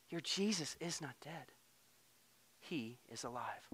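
hushed and tender, fading to a near whisper; soft gentle angel. Pretend. Angel gently telling weeping Mary he lives, hushed. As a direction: soothe her grief softly. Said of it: "a rhetorical question".

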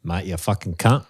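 imitating an Australian friend; mock Aussie insult; Australian accent. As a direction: swear in put-on Australian. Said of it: "One of my friend is Australian".

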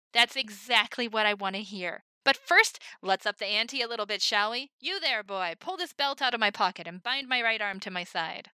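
big carnival presentation; showman patter. Acted. Himmel introducing the Human Top, loud showman voice.

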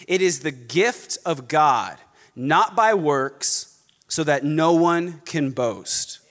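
slow, measured scripture reading with pauses; careful reading. Shown as a demonstration. Preacher reading Ephesians to congregation, very carefully. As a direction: give "grace", "gift" and "works" room, slowly. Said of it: "Read this very carefully".

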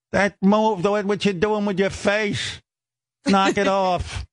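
high put-on nagging voice; hammed-up mom scolding, slowing at the end; scolding mother. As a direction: imitate mother's exasperated telling-off. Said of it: "And she goes".

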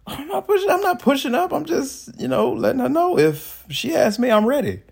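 high joking protest; mock defensive. Real, not acted. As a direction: playfully deny it.

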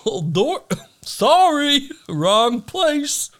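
high, loud, apologetic voice; sorry, wrong door, in a high voice; sheepish apology. Retold or imitated. Tunneler apologizing in warden's office.

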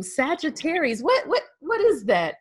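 ordinary talk, nothing marked

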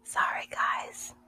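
hushed whisper. Real, not acted. Baby sleeping in next room.

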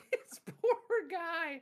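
slow, high, laughing through the words; laughing pity. Real, not acted. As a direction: crack up while pitying him. Laughing at the story.

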